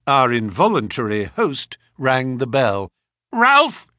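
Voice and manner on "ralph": loud sharp call to the butler. Acted. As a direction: summon him loudly. Colonel calling his servant.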